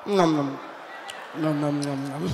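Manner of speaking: mock sniffing noise. Mimicking sniffing after sneeze.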